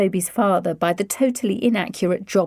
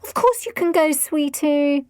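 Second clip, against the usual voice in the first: doting mother; 9 semitones higher than usual. A narrator's reading. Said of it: "Missus Bennet said at once".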